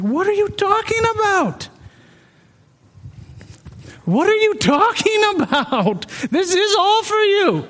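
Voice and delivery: high, incredulous disbelief; pushing back in bewildered surprise; baffled protest. Retold or imitated. Confused son answering God, high and incredulous. Stunned reply to God's question.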